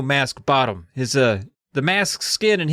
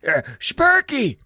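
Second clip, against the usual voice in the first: put-on high, slow, strained old man call; weak raspy calling; 10 semitones higher, slower than usual. Steve clears his throat and calls his dog again, high strained call. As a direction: try to call the dog and fail. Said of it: "tries again".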